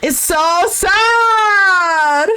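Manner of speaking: high, very drawn-out whine of sorrow; mock wail. Host overcome by her heartbreak, hammed-up wailing. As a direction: stretch it into a long, high mock cry.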